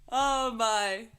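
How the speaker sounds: ordinary talk, nothing marked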